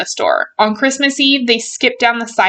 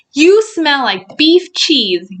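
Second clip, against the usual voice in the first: slow, higher-pitched accusation; indignant accusation; 6 semitones higher, 4 dB louder, slower than usual. Acted. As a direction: accuse the impostor, stretching each word. Buddy accuses the fake Santa.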